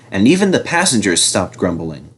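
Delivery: usual speaking voice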